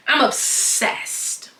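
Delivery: slow whispered confession; breathy whisper. Real, not acted. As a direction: whisper it, drawn out. Overwhelmed by the hair.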